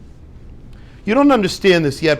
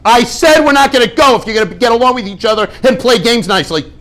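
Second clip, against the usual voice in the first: higher, louder hammed-up scolding parent; mock scolding parent; 5 semitones higher, 5 dB louder than usual. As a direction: play an over-the-top angry parent. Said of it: "You think the parents say".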